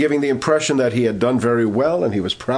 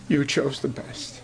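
mild tearful; 5 dB quieter, slower than usual. Retold or imitated. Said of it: "he began to weep".

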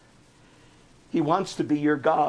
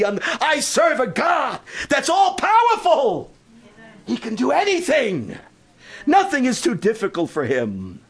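fervent preaching; 7 semitones higher, 3 dB louder than usual. Acted. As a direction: declare it to the rafters. Preaching swell.

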